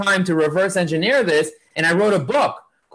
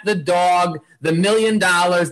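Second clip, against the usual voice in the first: normal talking voice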